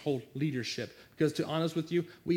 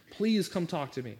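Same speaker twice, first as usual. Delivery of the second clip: ordinary talk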